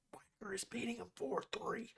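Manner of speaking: hushed disbelieving whisper; whispered disbelief; shocked whisper. Real, not acted. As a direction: breathe it in quiet shock. Shocked at upset score.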